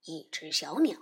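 low, soft wolf voice puzzling over it; a quiet, doubtful wolf's question; wolf asking. A narrator's reading. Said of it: "大灰狼问". (低声疑问的狼)

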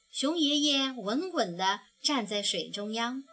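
usual speaking voice

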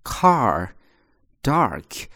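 car and dark with the tongue curled for the r; long ah then a curled r in car, dark; american r-colored vowel. Shown as a demonstration. Teacher shows the American curled r. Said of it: "都在卷舌头啊".

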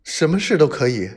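whimpering. Acted. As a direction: beg for your life, whimpering. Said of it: "他呜咽着说".

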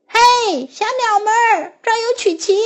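high, loud call to the birds; 大声呼喊. Acted. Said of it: "他大声喊道".

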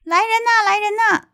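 very high acted call for help; servant crying out. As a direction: call urgently for someone to come. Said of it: "这个侍从又大喊".

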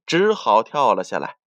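usual speaking voice

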